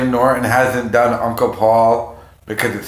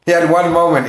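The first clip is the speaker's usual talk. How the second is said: usual speaking voice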